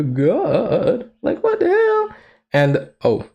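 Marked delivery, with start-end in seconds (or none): 0.0-1.1 startled squeak at the end; caught off guard. Real, not acted. Startled, pitch jumps up. 1.2-2.2 high squeaky disbelief; what the hell. Real, not acted. Reacting to fanfic twist.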